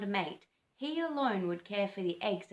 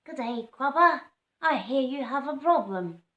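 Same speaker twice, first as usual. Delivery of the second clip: higher, louder sing-song bird call; warbled greeting; 5 semitones higher, 9 dB louder than usual. Acted. Scrub fowl calling out to Cobber, warbling higher. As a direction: lilt it like a bird. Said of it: "warbled an orange-footed scrub fowl".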